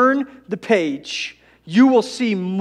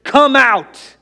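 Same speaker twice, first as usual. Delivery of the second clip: high, loud call to Lazarus; shouted command; 5 semitones higher, 9 dB louder, slower than usual. Acted. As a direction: shout it to reach the dead. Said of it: "So Jesus shouted".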